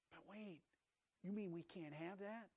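a hushed, hesitant challenge; Eve's doubt. Acted. Eve questioning the command.

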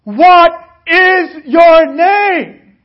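the king bellows the question at the soldier. A narrator's reading. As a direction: blast the question out like an angry king. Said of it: "got louder and he said".

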